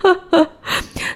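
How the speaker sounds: falling teary laugh; embarrassed laugh. Real, not acted. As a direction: laugh it off after getting emotional. After almost crying.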